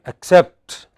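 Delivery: light ac-, strong -CEPT in Accept; final-syllable stress. Shown as a demonstration. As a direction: ACCEPT with a loud second syllable. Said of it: "words which have a stress on".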